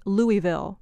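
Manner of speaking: Louisville said "LOO-ee-vill"; Louisville as "LOO-ee-vill", the Northern way; Yankee mispronunciation. Shown as a demonstration. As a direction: say Louisville with a clear EE and silent S. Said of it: "and definitely not Louisville".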